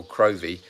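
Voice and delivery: "Crovey" said as spelt, with a long O; "Crovey" as an outsider would read it; alternative non-local pronunciation. Shown as a demonstration. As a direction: say "Crovey" with an O as in "rove". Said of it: "So we'd pronounce it".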